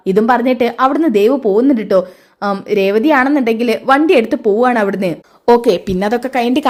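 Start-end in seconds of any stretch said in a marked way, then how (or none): none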